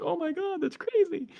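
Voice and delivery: high-pitched mock disbelief; mock amazement. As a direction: shriek it in a tiny high voice like a panicked bystander. Listener gasping at alien strength.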